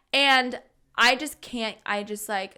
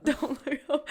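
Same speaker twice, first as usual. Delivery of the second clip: laughing self-warning; 4 semitones higher than usual. Retold or imitated. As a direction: repeat it with a laugh breaking through.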